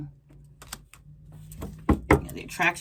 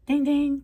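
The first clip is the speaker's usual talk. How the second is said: mock bell; 10 semitones higher, 5 dB louder than usual. Game show bell for a right answer.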